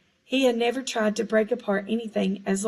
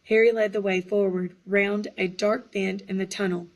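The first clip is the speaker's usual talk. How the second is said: ordinary delivery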